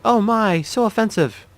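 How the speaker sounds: put-on high, soft girl's voice; prim high-pitched mock affront; offended girl. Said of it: "She's like".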